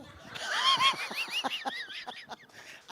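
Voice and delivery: very high burst of laughter; high squealing laugh. Real, not acted. Preacher to congregation, high squealing laugh.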